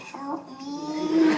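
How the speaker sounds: high strangled little voice in a friend's ear; mock choking squeak. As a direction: fake choking for a laugh. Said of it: "I practiced in my room without kink".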